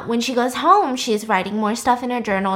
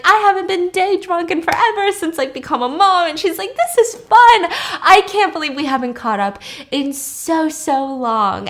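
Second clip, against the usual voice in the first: put-on high, louder, bubbly day-drunk voice; gushing tipsy mom; 8 semitones higher, 6 dB louder than usual. Drunk mom gushing to old friend, high and giddy. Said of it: "saying all this bullshit".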